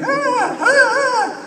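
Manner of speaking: high mock squeals of screaming ladies; mock fan screams, very high; imitated screaming. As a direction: squeal high like excited fans. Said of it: "a group of ladies who were just screaming".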